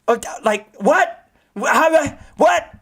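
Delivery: high, loud astonished what; stunned disbelief. Real, not acted. Streamer amazed by Rengoku's speed, surprised. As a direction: sputter in amazement.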